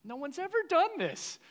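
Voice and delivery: very high, loud disbelief; retold amazement. Teenager amazed the cop is engaged, incredulous. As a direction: exclaim it in a high, astonished pitch. Retelling his amazement at cop's interest.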